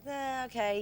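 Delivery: mild reluctant "eh". Retold or imitated. Girlfriend answering her boyfriend's dinner plan, reluctant. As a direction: go along half-heartedly, retold.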